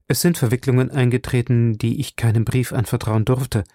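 ordinary delivery, nothing marked

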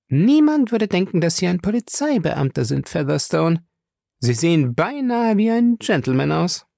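high-pitched woman's voice with a teasing edge; Fay's character voice, teasing. Acted. Fay teases Featherstone about his looks, in a high voice. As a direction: play a pert lady needling an acquaintance.

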